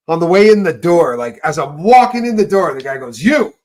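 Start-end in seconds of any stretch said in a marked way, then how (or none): 3.2-3.5 loud, high-pitched guard's challenge; imitating a guard's shout; sudden call-out. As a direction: call someone out sharply in one word. Guard calls him out at door.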